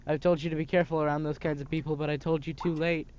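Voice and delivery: usual speaking voice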